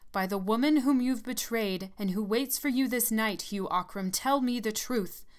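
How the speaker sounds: put-on old nurse demanding the truth from the dying man; commanding. A narrator's reading. Third demand, climax.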